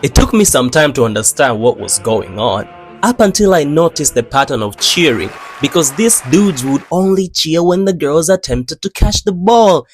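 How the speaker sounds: ordinary delivery, nothing marked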